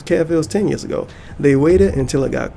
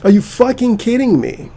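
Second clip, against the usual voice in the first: much higher, louder outburst; outraged disbelief; 9 semitones higher, 3 dB louder than usual. Real, not acted. Vlogger furious at fans forgiving Fred, high loud outburst. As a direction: explode in disbelief.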